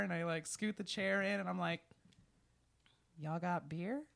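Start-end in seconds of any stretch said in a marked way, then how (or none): 3.2-4.0 put-on Texan accent, soft and low; hint of a Texan accent asking for beer; lightly put-on Texan accent; Texan drawl. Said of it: "You have to say it like that?".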